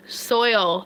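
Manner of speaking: "Soil" with a clear oy, SOY-ol; deliberate two-part "Soil", soy-ol; careful pronunciation. Shown as a demonstration. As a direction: sound out the oy in "Soil". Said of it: "Did I say it right that time?".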